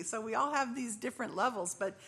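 ordinary delivery

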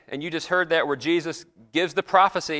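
normal talking voice

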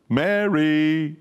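"Mary" stretched long, up then held level; drawn-out "Mary". Shown as a demonstration. Second guess at how Jesus said it, long-held "Mary". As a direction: sing out "Mary" slowly, holding the last vowel. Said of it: "Or did he say".

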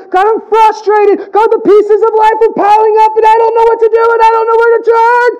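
high, loud, anguished cry to God; desperate crying prayer. Retold or imitated. A pastor acting out a desperate prayer to God, crying out loud. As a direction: plead with God as everything piles up, near tears. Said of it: "a great picture of an honest prayer".

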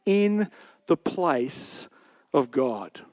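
"Am I in the place of God" drawn out slowly, each word weighed; slow and weighty. Shown as a demonstration. Preacher underlining a key verse, slow and weighty. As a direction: let the key question land slowly. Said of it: "key phrase that Joseph uses here".

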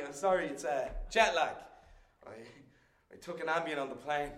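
ordinary talk, nothing marked

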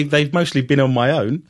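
ordinary talk

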